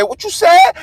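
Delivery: sudden high startled question; mock surprise. Vlogger retells hearing the dog speak, mock startled. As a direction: jump up in pitch, caught off guard. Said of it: "And I said".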